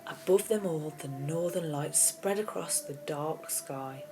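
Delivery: plain reading voice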